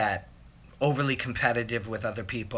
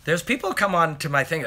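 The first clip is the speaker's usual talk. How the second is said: normal talking voice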